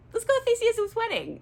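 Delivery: very high, sing-song coaxing; mock coaxing. Oberon luring his wife to the wedding, mock coaxing. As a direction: put on a silly high voice to distract her.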